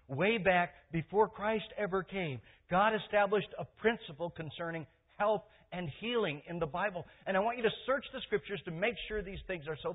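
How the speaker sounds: high, loud, emphatic preaching; preacher building to testimony; fervent preaching. Acted. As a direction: testify with full force. Preaching at full force.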